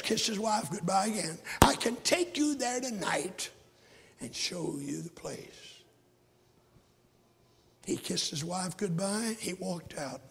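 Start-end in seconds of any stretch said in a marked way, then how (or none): 4.2-5.8 soft, slow whisper; preacher whispering to the congregation; hushed aside. Real, not acted. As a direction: lean in and whisper it.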